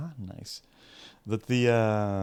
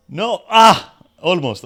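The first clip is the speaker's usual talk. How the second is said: sudden scream, far higher and louder; real scream of frustration; 8 semitones higher, 15 dB louder, slower than usual. Real, not acted. Gamer screams at a missed jump, sharp real yell. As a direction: yell at the jump nearly failing. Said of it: "sorry for screaming".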